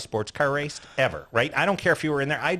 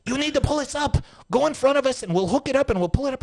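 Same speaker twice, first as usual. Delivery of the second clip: higher, lightly put-on voice for the two stuck guys; mock stranded guys; 12 semitones higher than usual. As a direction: play the stranded drivers asking for a tow, pitched up. Imitating the stuck bug guys.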